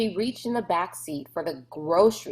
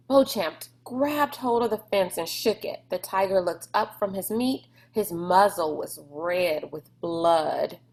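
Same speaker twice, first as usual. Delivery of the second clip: ordinary delivery, nothing marked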